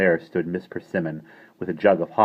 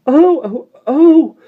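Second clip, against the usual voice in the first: gasping; 14 dB louder, much slower than usual. A narrator's reading. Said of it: "Jane as she gasped for breath".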